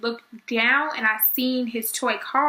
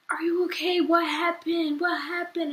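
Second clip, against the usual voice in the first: higher, worried questions; concerned relatives; 5 semitones higher, slower than usual. Retold or imitated. Relatives asking high and worried what happened. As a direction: fuss over her like anxious family.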